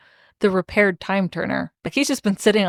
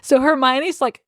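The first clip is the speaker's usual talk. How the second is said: ordinary delivery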